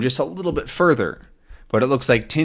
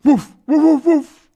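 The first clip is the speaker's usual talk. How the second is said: loud high-pitched imitated woofs; dog barking; 7 dB louder than usual. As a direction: bark like an excited dog. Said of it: "we see the dog".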